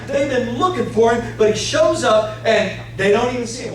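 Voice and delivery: preacher booming at the church; preaching loud. Real, not acted. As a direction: hammer it out to the congregation. Preacher's emphatic climax.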